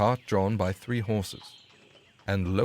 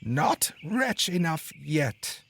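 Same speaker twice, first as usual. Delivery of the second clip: very high, slow mocking bird voice; taunting sparrow; 7 semitones higher, much slower than usual. A narrator's reading. Sparrow mocking the unlucky carter, slow high taunt. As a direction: taunt him slowly from up high. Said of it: "the sparrow answered".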